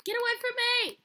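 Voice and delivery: high-pitched frightened demand; panicked cry. Real, not acted. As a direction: cry out while fending off an attacker. Fleeing the attacker.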